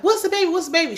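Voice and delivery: high, urgent mock cry; retold screaming. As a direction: call out as a mother searching in panic. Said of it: "She's screaming for the".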